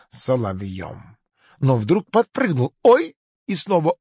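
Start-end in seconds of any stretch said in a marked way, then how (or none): 2.8-3.1 loud high "oy"; sudden high cry of alarm; startled yelp. Acted. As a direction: yelp as if jabbed. Crocodile jumps in sudden pain.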